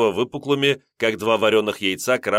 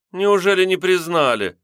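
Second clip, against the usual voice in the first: высоко и громче, изумлённо; искренне изумиться, что не узнали; удивлённо; 9 semitones higher, 4 dB louder than usual. A narrator's reading. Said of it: "несказанно удивилась женщина". (кондукторша изумлена, что её не признали)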